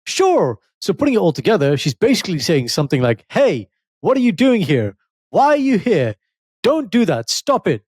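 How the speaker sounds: high, mock urgent read of ChatGPT's translation; hammed-up robotic translator voice. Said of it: "here's what Chat G P T said".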